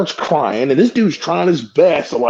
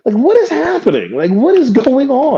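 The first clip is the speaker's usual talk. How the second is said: high disbelieving questions; baffled; 10 semitones higher than usual. Retold or imitated. Retelling the chaos, high baffled questions. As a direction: voice up high in confusion. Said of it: "retelling the confusion".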